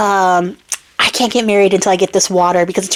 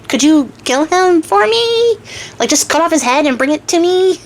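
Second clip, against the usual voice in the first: sweet wheedling; 6 semitones higher than usual. Acted. Princess asking Charming to kill the ogre, wheedling. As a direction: stretch it upward into a coaxing question. Princess asks champion a favor.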